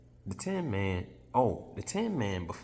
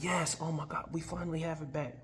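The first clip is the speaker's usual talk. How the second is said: excited Ozma; 9 semitones higher, 3 dB quieter than usual. Retold or imitated. Ozma relieved at return.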